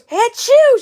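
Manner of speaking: loud, high fake "achoo"; mock sneeze. Someone sneezing near the doll, loud mock sneeze. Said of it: "Someone goes".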